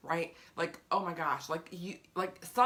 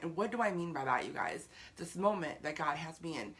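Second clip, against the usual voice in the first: usual speaking voice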